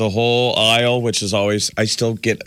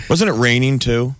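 ordinary talk

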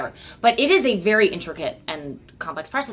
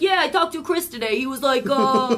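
all-purpose impression voice; 4 semitones higher than usual. Shown as a demonstration. Showing how she imitates anyone, goofy stock voice. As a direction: do a silly catch-all guy, laughing. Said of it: "I do that voice all the time".

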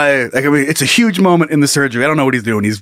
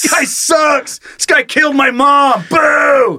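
high shouted jeers; heckling fan; 10 semitones higher, much slower than usual. Pretend. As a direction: scream abuse from the stands.

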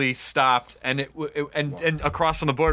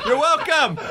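laughing; 13 semitones higher, 4 dB louder than usual. Real, not acted. Joke bit, laughing.

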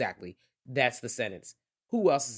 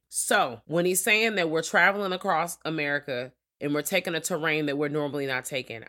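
usual speaking voice

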